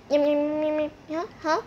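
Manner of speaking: usual speaking voice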